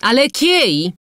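high, loud indignant yell; yelled question. A narrator's reading. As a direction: yell it at them as a challenge. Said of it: "wrzasnęła". (wrzaskliwe, wysokie pytanie)

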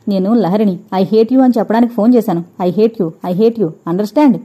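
usual speaking voice

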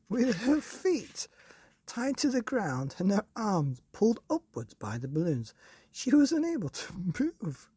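reading through bursts of laughter; can't keep a straight face; high, laughing storyteller; giggling narration. A narrator's reading.

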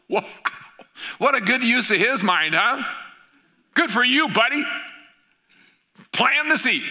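high, loud cheering; delighted exclaim. Retold or imitated. Preacher voicing his delight at the guide, high and loud. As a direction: cheer the man on with joy.

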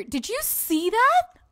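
high, slow rising question; shocked disbelief. Real, not acted. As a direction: stretch it upward in astonishment. Shocked at someone dying.

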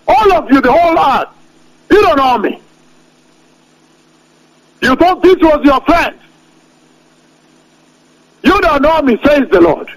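preaching as God. Acted. Preacher speaking as the Lord to unrepentant nations, stern proclamation. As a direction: thunder God's warning at the rebellious.

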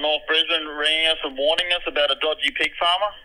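ordinary talk

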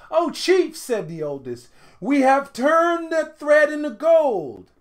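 high, loud and slow with triumph; jubilant report. A narrator's reading. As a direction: burst in proud and breathless. Breathless brothers tell chief.

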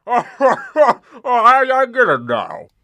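high, loud laughing; laughing realization. Acted. Monster laughing as he finally gets the joke. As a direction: crack up as it dawns on you.

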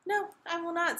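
high, firm little refusal; defiant refusal. A narrator's reading. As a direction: dig in like a stubborn princess. Princess refuses the exasperated king.

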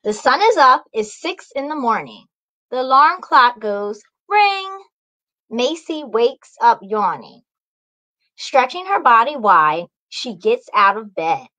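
bright, lively read-aloud; cheerful story time voice; storybook narration. A narrator's reading. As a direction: wake the kids up with the morning. Picture-book narration.

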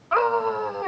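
overwhelmed high ah; frazzled ah. Real, not acted. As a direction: let out a stressed ah. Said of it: "so I'm just kind of like".

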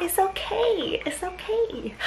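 soft high it's-fine; self-reassurance. Real, not acted. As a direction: talk yourself down kindly. Reassuring herself after workout.

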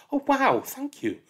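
put-on excited child. Birthday child thanks the teacher, thrilled. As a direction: gush like a thrilled kid.

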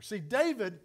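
ordinary talk, nothing marked

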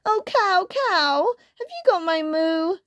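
high, pleading kitten voice; squeaky storybook kitten; little kitten. A narrator's reading. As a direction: ask it like a tiny kitten. Kitten asks cow in story.

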